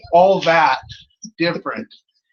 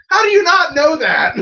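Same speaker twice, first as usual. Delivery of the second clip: higher, louder incredulous shout; mock outraged yell; 10 semitones higher, 5 dB louder than usual. Joking about the odd wrestler name, incredulous shout. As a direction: shout it like it's obvious. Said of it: "I'm like".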